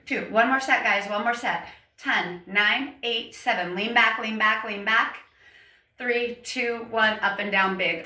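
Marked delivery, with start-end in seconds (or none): none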